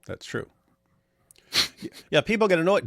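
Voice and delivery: ordinary talk, nothing marked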